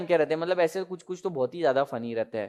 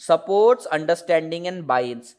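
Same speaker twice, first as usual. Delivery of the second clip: slow dictation; much slower than usual. Shown as a demonstration. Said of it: "का मतलब है".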